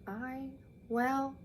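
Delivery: soft, faltering clerk; hesitant. Acted. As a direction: trail off, stuck for words. Clerk caught off guard.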